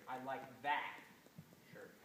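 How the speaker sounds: "shirt" held apart and leaned on after a pause; pause, then punch "shirt"; stress on shirt. Shown as a demonstration. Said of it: "Emphasize one particular word".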